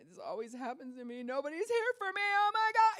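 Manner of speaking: climbing from upset to a high, loud wail; building panic. Retold or imitated. Person overwhelmed by pain, escalating to a panicked wail. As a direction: spiral up into a full meltdown. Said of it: "And you'll just lose it".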